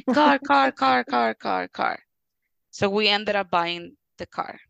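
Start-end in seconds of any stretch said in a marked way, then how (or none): 0.1-2.0 put-on high, loud childish chant of car, fading; mimicking a little boy begging for a toy car; toddler wanting. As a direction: chant car like a small child who wants it.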